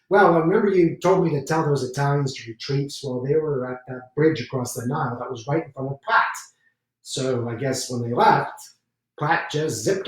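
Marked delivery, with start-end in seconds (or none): none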